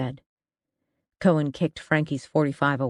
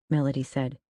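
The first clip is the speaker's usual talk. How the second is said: ordinary delivery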